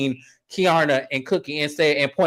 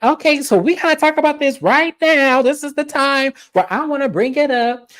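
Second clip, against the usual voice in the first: high retold quote; 11 semitones higher than usual. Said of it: "Kay comes up and was like".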